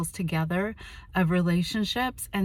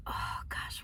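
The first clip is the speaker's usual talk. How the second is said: hushed whisper; 12 dB quieter than usual. Retold or imitated. Admitting guilt, hushed.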